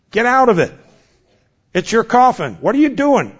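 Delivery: insistent sermon call; exhorting preacher. Acted. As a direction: push them out of the cave. Exhorting the congregation.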